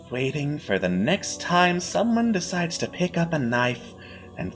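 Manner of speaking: smug, patronising villain. Acted. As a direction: purr it out like a gloating host. GM villain voice continues.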